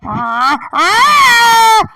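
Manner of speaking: loud double roar climbing high; screeching roar that jumps up in pitch; dragon screech. Acted. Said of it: "but the screech couldn't stop".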